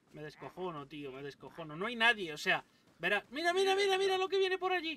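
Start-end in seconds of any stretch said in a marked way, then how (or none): 3.3-5.0 much higher, urgent repeats; excited alarm. Real, not acted. Gamer seeing enemies approach, calling out with excitement. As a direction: warn of what's coming.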